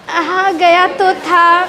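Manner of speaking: slow, embarrassed half-laughing excuse; a sheepish student making excuses. Shown as a demonstration. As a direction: chuckle at your own mistake. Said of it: "चेहरा में हंसी भी है लेकिन शर्मिंदगी है".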